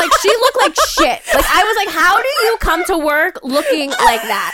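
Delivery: laughing hard while telling it; can barely talk through laughter. Real, not acted. Laughing while retelling angry story.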